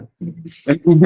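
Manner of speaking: short laugh at own joke; brief, real chuckle. Real, not acted. (छोटी सी हँसी)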